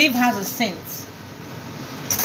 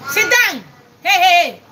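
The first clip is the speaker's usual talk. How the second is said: real scolding shout; 4 semitones higher, 5 dB louder than usual. Real, not acted. Mother ordering her toddler onto the potty, loud.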